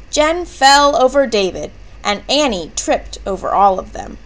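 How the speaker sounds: ordinary delivery, nothing marked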